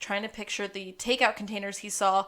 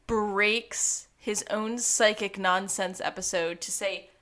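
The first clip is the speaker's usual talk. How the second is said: ordinary delivery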